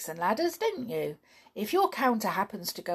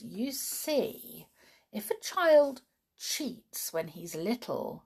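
plain reading voice

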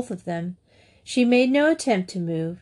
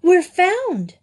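joyful shout; 11 semitones higher, 6 dB louder than usual. A narrator's reading. Rescue arrives.

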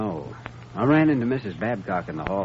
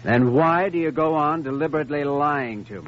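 raised, pressing confrontation; firm doctor pushing hard to break her down; stern accusation; 7 semitones higher than usual. Acted. Doctor confronts lying girl.

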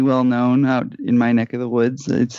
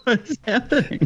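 high laughing question; 10 semitones higher, 3 dB quieter than usual. Real, not acted. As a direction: ask it through laughter. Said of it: "We got the giggles".

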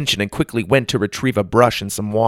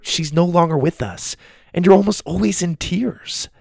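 lightly voiced child, mostly higher; explaining granddaughter; 7 semitones higher than usual. A narrator's reading.

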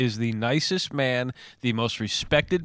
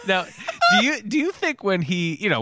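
ordinary talk, nothing marked

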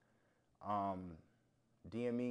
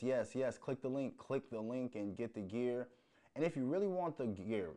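usual speaking voice

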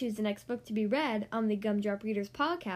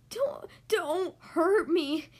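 slow, trembling plea; whimpering; 4 semitones higher, 3 dB louder, much slower than usual. Acted. Frightened lion whimpers to mouse, slow and shaky. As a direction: beg while trembling. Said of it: "he whimpered".

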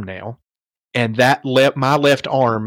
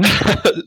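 short laugh; 8 semitones higher than usual. Real, not acted. Laughs at own joke.

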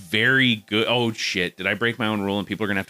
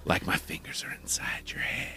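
ASMR whisper; 10 dB quieter than usual. Pretend. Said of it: "A S M R podcast".

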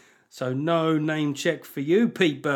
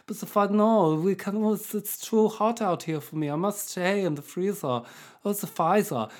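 fussy, higher-pitched comic German; German accent; 5 semitones higher than usual. Acted. Host playing Pfizer too hot outside the freezer, German accent. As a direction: complain delicately in a mock-German accent. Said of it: "giving it a German accent".

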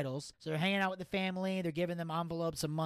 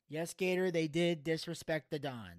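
ordinary talk

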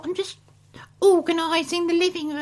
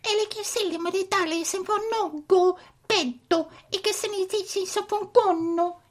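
mock Italian gibberish; hammy pretend Italian accent; fake Italian. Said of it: "Now we can speak Italian".

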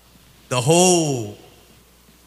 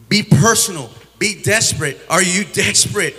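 punchy, higher preacher's challenge; raised, emphatic exhortation; retold urgent plea; 4 semitones higher, 4 dB louder than usual. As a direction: press her hard, as in a re-enacted altar call. Retelling his plea to her.

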